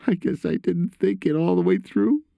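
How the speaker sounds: put-on high, upset boy's voice; remorseful son. A narrator's reading. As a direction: admit it in a son's high, sorry voice.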